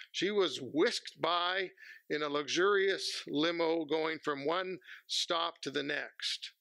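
ordinary talk, nothing marked

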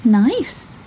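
a small clever fish flattering the whale; small 'stute voice. A narrator's reading. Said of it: "said the small 'stute fish".

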